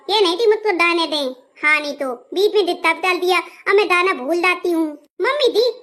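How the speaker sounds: indignant lisped complaint; lisping. Acted. Bride complaining about guests interrupting her song, lisping. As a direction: scold with softened, babyish consonants. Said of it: "मैं भी तोतली हो जा रही हूँ".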